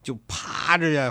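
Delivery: plain reading voice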